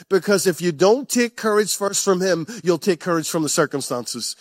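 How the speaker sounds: ordinary delivery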